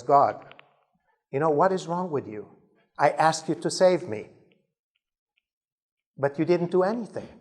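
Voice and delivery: mildly indignant complaint, pitch climbing; lightly acted aggrieved questioning; very upset. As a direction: protest as if badly let down. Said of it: "is very upset".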